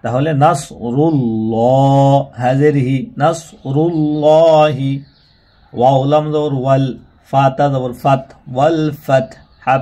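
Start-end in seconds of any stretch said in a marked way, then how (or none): 0.4-2.2 slow, drawn-out tajweed chant; Quran recitation. Shown as a demonstration. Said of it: "বানান করে।". 3.2-4.9 slow, drawn-out tajweed chant; Quran recitation. Shown as a demonstration. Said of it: "বানান করে।".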